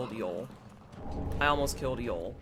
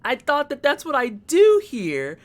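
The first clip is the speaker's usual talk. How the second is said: higher, slow, wide-eyed innocent explaining; innocent self-quote; 11 semitones higher, 9 dB louder than usual. Retold or imitated. Streamer retelling her reply to panicking chat, mock innocent. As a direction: sound naively pleased with yourself. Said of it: "I was like".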